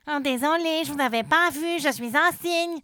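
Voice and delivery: shy swan saying sorry; sheepish swan. A narrator's reading. Swan apologises. (cygne gêné qui s'excuse)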